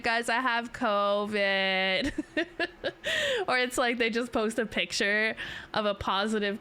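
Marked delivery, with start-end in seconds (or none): 0.0-2.1 drawn out slowly, mock gloomy; slow mock announcement. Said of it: "They're like". 2.2-3.4 very high falling giggle. Real, not acted. Laughs at covid joke.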